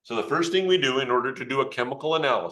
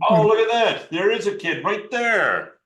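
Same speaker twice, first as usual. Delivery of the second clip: high, louder excited exclamation; delighted discovery; 8 semitones higher, 5 dB louder than usual. Real, not acted. Presenter spots a child volunteer, delighted. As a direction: burst out as if thrilled to find them.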